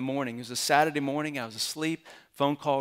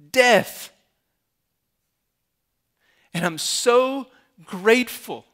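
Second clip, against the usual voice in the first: loud, high, slow declaration to the congregation; ringing, emphatic pulpit proclamation; impassioned preaching; 6 semitones higher, 10 dB louder, slower than usual. Real, not acted. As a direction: preach it at full force, word by word. Preaches at full force.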